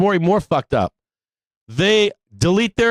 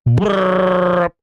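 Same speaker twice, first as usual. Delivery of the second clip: a quick mock "brrr" buzzing sound; imitated phone buzz; 2 semitones higher than usual. Host miming his DMs buzzing, mock buzz. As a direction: buzz like a flooded inbox.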